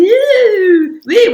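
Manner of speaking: high, loud wavering spooky yoo; mock the séance crowd moaning for ghosts; mock ghostly wail. Mocking people who fake spirit contact, high ghostly wail. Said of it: "going, ... with their hands".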